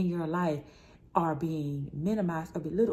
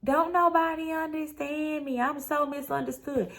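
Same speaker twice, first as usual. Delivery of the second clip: imitating mom's complaint; 8 semitones higher than usual. Daughter mimicking her mother's old complaint, whiny and high. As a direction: do your mom sulking that nobody gets her. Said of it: "my mom used to say".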